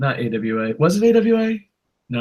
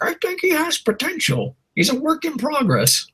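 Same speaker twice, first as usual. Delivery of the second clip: much higher, mocking commentator voice; mocking Jim Ross; 12 semitones higher than usual. Mocking Jim Ross's company-line commentary in a high voice. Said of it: "he's like".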